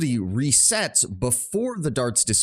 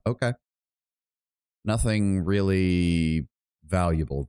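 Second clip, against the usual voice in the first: ordinary delivery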